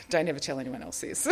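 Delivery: normal talking voice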